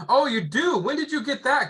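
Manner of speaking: high, bright mock surprise at the objection. Salesman to homeowner in role-play, playing surprised. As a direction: act amazed he already has a policy.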